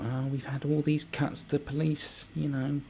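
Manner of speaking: high, slow mock whine; hammed-up whingeing official; mocking a whiny police chief pleading poverty; whining police commissioner. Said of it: "whining on about".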